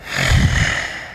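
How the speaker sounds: slow unvoiced demonstration sigh; audible sigh. Shown as a demonstration. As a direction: let out an audible sigh from the throat. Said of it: "the sound produced when we sigh".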